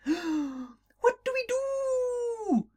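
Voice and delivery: high, slow little voice; little fish yelling. Acted. The little fish crying from the snake's belly, high and slow. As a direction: squeak it out in a panic. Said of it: "the little fish yelled".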